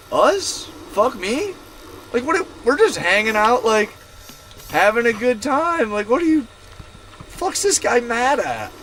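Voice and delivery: high-pitched mock disbelief; retold incredulous protest in a high voice; baffled indignation. Acting out baffled reaction to insult.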